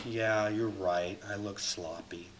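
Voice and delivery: low, slow, dejected mock agreement; giving in. Owning someone's criticism of looking sloppy, meekly defeated. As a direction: sink softly into acted defeat. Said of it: "but I'm not also going".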